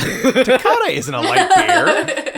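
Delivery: high, slow, through laughter; laughing. Real, not acted. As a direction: squeal it while laughing. Laughing correction.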